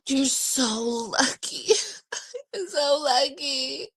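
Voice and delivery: crying-laughing, slow and high; choked up with happy envy; tearful. Real, not acted. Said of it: "I wanna cry".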